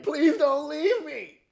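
very high, slow whimpering plea; scared friend pleading not to be left; whiny begging. Retold or imitated. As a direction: stretch it out like a desperate whimper. Buddy begging.

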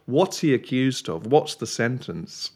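ordinary talk, nothing marked

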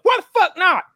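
high, loud mock yell; hammed-up defiant woman; mock defiance. Guest playing the woman yelling back at the cop, high and loud.

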